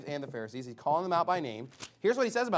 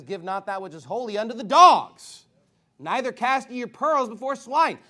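raised, loud preaching voice; forceful scripture quote; 4 semitones higher, 9 dB louder than usual. Acted. Preacher quoting Jesus on dogs and swine, forcefully. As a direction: drive it home, punching the last words.

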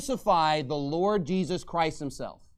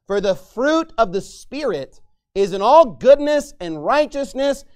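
scripture reading; 6 dB louder than usual. Acted. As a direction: read it out like holy writ. Reads scripture aloud.

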